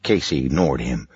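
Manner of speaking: ordinary delivery, nothing marked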